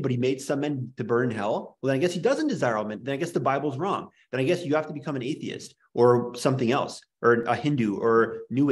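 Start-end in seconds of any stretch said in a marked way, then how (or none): none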